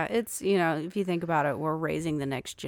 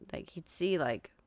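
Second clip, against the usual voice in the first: usual speaking voice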